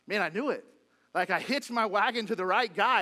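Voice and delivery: thrilled he backed the winner; excited Peter. Retold or imitated. Peter to himself, hearing Son of Man, loud and thrilled. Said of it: "he's like".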